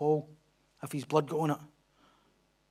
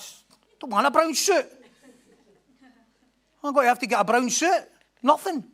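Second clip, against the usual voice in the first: 1 mock protest; 6 semitones higher, 4 dB louder than usual. Retelling fear of brown suit.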